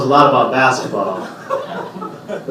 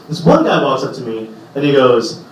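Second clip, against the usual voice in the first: ordinary delivery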